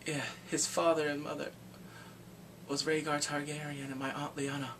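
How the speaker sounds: imitating an offhand reveal; casual. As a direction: drop the news softly, unbothered. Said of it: "he said it all casual".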